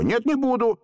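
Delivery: резко и высоко; вспылить в ответ; hot-tempered refusal. A narrator's reading. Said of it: "запальчиво ответил". (запальчиво)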